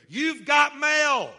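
drawn out, very loud and high; slow booming announcement. Acted. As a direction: boom it slowly to the whole church. Preacher's climactic announcement.

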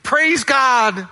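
high, exultant cry of praise; joyful shout. Acted. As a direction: shout it out in thanks. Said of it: "came back to Jesus shouting".

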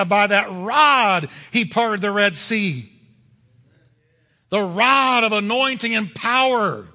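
loud, rising preacher's cry; fiery sermon climax; preaching. Acted. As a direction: preach it with full force, building. Preaching at full force.

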